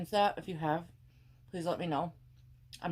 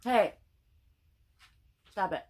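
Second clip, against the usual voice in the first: sharp, loud call to the dog; scolding dog; 3 semitones higher, 6 dB louder than usual. Real, not acted. Scolding her dog scratching the floor, sharp and loud.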